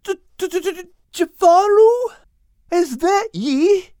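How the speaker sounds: high stuttered question, stretched out in fear; frightened stutter. Acted. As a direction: stammer in a high, scared character voice. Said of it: "Al stuttered".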